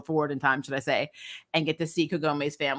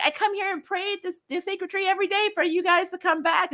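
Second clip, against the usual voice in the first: put-on much higher, eager girlish Mei voice; excited Mei; 12 semitones higher than usual. Mei greeting the returning girls: high, eager gushing. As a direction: gush it like a thrilled young girl. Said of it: "She's like".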